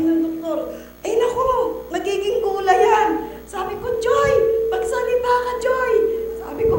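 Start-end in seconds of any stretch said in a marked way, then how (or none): 1.1-3.1 higher, louder dismay; doctor warning the mother in alarm; alarmed exclamation. Retold or imitated. 4.0-5.9 high, loud calling of the name Joy; mother calling to her daughter to speak, loud and high. Retold or imitated.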